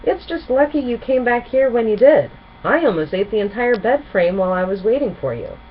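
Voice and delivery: Spike, eager. Acted. Spike's voice, hungry dragon.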